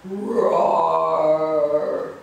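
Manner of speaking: loud, drawn-out growling roars; dinosaur roars. Acted. Dinosaurs roaring at the kitties, loud long roars. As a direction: roar like a big dinosaur, each one held long.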